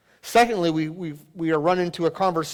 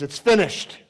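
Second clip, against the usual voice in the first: higher, louder victorious cry; 5 semitones higher, 5 dB louder than usual. Acted. Jesus announcing from the cross his work is complete, crying out. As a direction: call it out as a triumph. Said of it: "he cries out and says".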